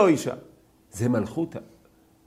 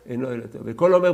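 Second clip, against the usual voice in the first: usual speaking voice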